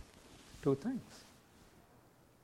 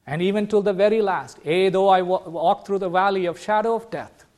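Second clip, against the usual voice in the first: louder and higher, rising scripture quote; preacher quoting the valley of shadow, fervent; fervent preaching; 4 semitones higher, 6 dB louder than usual. Acted. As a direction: proclaim the psalm with passion. Preaches the psalm at full force.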